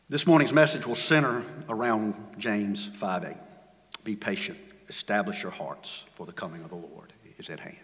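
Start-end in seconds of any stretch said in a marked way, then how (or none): 4.0-7.8 fading to a near whisper; quiet solemn close; soft scripture close. Acted. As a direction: let it die away softly. Closing scripture line, very soft.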